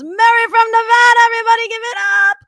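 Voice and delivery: imitating the DJ hyping Mary from Nevada; hype DJ. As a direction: whip up the crowd for the contestant. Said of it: "He goes".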